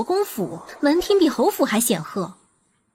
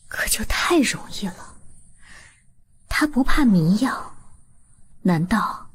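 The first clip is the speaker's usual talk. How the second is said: whispering; 3 semitones lower than usual. Acted. Sister whispers poisoning plan.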